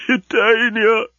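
high, wailing sob of a name; tearful, high-pitched wail; sobbing. A narrator's reading. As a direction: cry out the name in hammed-up grief. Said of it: "he was sobbing".

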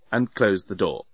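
ordinary delivery, nothing marked

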